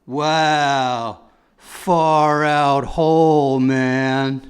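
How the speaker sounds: slow, laid-back hippie drawl; hippie dog. Pretend. Comedian voicing a sixties dog, slow hippie drawl. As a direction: stretch it out like a stoned hippie. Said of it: "nineteen sixty-nine dog".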